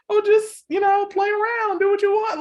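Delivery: high, loud mocking voice of a careless guy; mocking the boyfriend. Mocking the guy's careless advice.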